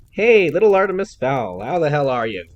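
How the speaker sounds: put-on a flashy American tycoon greeting the boy loudly; brash American greeting. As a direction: boom it across the restaurant like an old pal.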